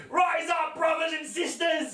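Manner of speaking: high rallying shout; fiery revolutionary speech. Pretend. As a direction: rouse the crowd, mock heroic. Mock call to revolution.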